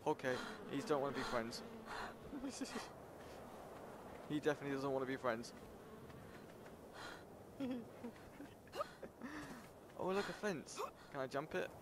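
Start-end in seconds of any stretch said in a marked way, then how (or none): 1.7-4.2 soft high-pitched giggle; high muffled laugh; nervous laughter. Real, not acted. Creature will not be friends. 5.6-10.0 long, soft high giggle; high, hushed giggles; nervous giggling. Real, not acted. Fleeing an unfriendly creature.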